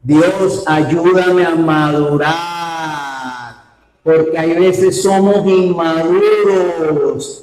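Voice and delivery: preacher praying aloud for maturity; fervent preaching. Acted. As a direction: pray it out over the church. Preaching prayer and exhortation.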